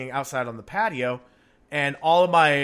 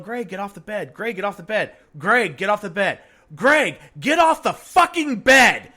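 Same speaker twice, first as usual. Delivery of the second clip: from plain to a loud high yell; building frustration; 6 semitones higher, 8 dB louder than usual. Retold or imitated. Five-year-old yelling at her brother, building to loud fury. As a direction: get madder with each repeat. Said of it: "she's laying there going".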